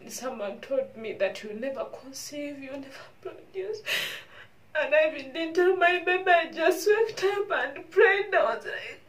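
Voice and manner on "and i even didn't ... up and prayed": loud, high, rising emotion; real emotional telling. Real, not acted. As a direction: pour it out, moved. Emotional retelling of her prayer.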